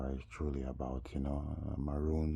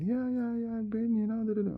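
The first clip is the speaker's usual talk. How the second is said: very high falsetto chatter; hammed-up squeaky impression; mock eager woman; 4 dB louder than usual. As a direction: imitate her babbling agreement. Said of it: "she's been".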